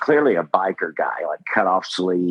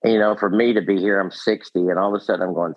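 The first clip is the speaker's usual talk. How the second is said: ordinary delivery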